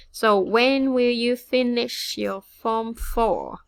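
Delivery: slow, loud, deliberate quiz question; slow careful test question. Shown as a demonstration. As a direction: read it slowly and clearly, like a test prompt. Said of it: "this is to test you".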